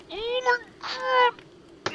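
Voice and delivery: very high, slow strained falsetto; strained squeal. Pretend. Gamer's joke falsetto outburst over his pet. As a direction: squeeze it out way up high. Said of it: "That sounded kinda constipation-ish".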